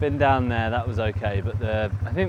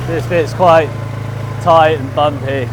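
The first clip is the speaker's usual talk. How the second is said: shouting over engine; 5 semitones higher, 7 dB louder than usual. Real, not acted. Riding bike over engine noise.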